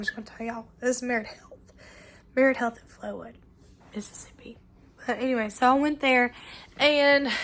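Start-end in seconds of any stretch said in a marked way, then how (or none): 2.8-4.6 whisper. Real, not acted. Naming the hospital's town aside.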